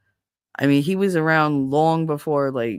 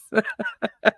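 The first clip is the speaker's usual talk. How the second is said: helpless giggle; laughing; 3 semitones higher than usual. Real, not acted. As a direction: burst into laughter. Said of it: "I start to laugh".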